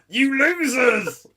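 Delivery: high, loud, drawn-out mock taunt; gleeful mock-insult holler; playful shout. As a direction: yell it out slowly as a joking sign-off. Mock-insult sign-off shouted at listeners.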